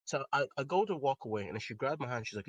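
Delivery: normal talking voice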